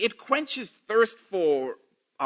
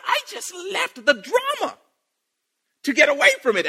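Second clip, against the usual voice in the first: lightly voiced, higher, mock-exasperated Jesus; weary Jesus; 11 semitones higher than usual. Jesus escaping the drama, a light mock-weary high voice. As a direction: sigh it like he's had enough drama. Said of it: "Jesus is like".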